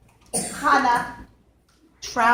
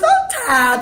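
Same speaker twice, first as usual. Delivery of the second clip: giddy daughter; 10 semitones higher, 6 dB louder than usual. Acted.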